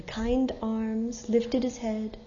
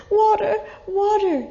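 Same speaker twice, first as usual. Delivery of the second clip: higher, louder desperate cry; 11 semitones higher, 6 dB louder than usual. Acted. Dying merchant begging the traveler, desperate cry for water. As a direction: cry out for water with what's left. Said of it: "he cried".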